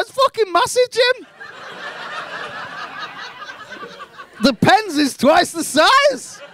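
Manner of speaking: high, thrilled report to dad; hammed-up childish amazement; excited schoolkid.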